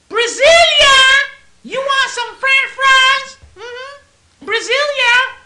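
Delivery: very high, slow, loud falsetto caricature; woman on bus. Retold or imitated. The fries lady calls her friend, very high falsetto. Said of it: "all of a sudden she says".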